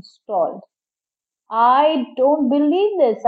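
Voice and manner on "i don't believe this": slow, drawn-out, higher-pitched disbelief; muttered disbelief. A narrator's reading. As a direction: stretch it out in exasperated disbelief. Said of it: "I muttered".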